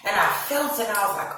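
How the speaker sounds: usual speaking voice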